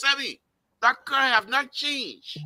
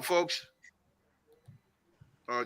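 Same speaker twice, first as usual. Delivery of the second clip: ordinary talk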